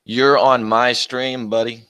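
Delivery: drawn out word by word; slow, deliberate put-down; slow, pointed. Real, not acted. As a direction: spell it out slowly like he's dense. Telling off a chatter.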